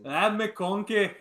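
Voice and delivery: drawn-out mock Scottish accent. Co-host echoing a player's name, mock Scottish accent. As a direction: stretch the name out in playful Scottish. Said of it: "saying that in a Scottish accent is amazing".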